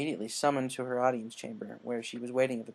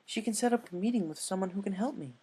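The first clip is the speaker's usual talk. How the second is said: higher elegant lady pony voice; higher voiced elegant unicorn with an idea; refined Rarity; 7 semitones higher than usual. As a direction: play a poised, eager fashionista.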